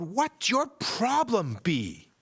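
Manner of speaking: put-on boy yelling in frustration at a bee; exasperated yell. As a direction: throw it up in exasperation. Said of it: "threw his hands in the air and yelled".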